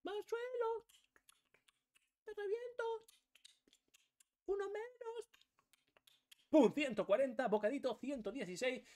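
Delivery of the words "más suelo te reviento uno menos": much higher, squeaky cartoon voice; high cartoonish voice; high put-on voice. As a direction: squeak it like a tiny cartoon hero. Character voice lines in game.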